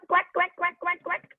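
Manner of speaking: high repeated quacks; duck quacking. Shown as a demonstration. Teacher getting children to join in, high duck quacks. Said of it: "how do they quack?".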